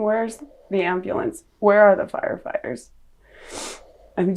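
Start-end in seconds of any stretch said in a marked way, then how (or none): none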